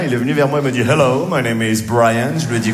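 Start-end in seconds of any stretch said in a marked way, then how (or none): 0.9-2.3 lower, slow American introduction; American producer. Retold or imitated. American film producer greets the comic, low slow greeting. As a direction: introduce yourself slowly in English, pitched down. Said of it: "un grand producteur de cinéma américain".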